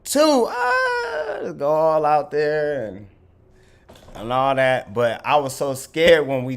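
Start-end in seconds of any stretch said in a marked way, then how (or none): 0.0-1.5 high yelled countdown, imitated; mock instructor shouting the count. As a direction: count off then yell "ah" way up high. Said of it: "he was like".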